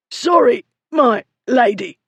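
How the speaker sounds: high, choked, halting apology; high-pitched, grudging gasp; strained apology. A narrator's reading. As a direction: squeeze out an apology after being throttled. Throttled bully forced to apologise.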